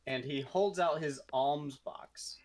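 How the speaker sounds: normal talking voice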